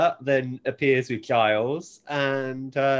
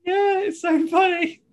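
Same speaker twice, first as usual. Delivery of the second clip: much higher, louder delighted cry; excited shout; 5 dB louder than usual. Real, not acted. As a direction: burst out in amused delight. Amused agreement shouted.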